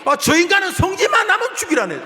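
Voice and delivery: high, loud, sulky whine of a prophet; petulant Jonah. Acted. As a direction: throw a tantrum like a spoiled prophet. Voices sulking Jonah. (토라진 요나의 높고 큰 투정)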